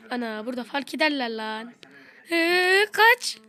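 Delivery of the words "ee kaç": high, loud panicked cry; panicked shout. Real, not acted. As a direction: yell to run away in panic. Chased by seeker, panics.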